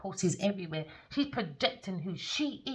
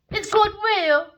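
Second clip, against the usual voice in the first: much higher and louder, almost shrieking; emphatic high shout; 13 semitones higher, 10 dB louder than usual. Real, not acted. Reader shouting at the energy, high and loud. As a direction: declare it like it's beyond doubt. Insisting on a tarot point.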